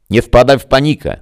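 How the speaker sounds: a voice breaking up over a bad link; garbled radio voice. A narrator's reading. Greta calling Max through a failing link, garbled and high.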